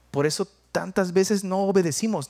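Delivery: normal talking voice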